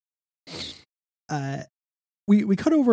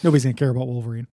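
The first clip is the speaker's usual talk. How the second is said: rushed mock dismissive; much faster than usual. Mocking the writers' thinking.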